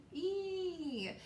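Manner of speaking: high, drawn-out long E; long and strong E; long E sound. Shown as a demonstration. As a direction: sing out the long vowel E. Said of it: "and what do they say?".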